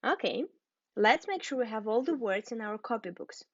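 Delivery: usual speaking voice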